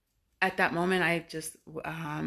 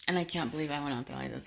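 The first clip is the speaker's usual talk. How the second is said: ordinary delivery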